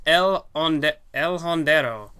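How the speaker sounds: put-on a light, slowed Spanish accent on the name; attempted Spanish accent. Commentator introducing the luchador opponent, attempted Spanish accent. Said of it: "do my best Spanish accent".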